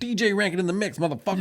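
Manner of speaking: high-pitched hype DJ call; DJ shout-out. Acted. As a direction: announce it like a DJ over a track. Imitates a DJ's shout-out.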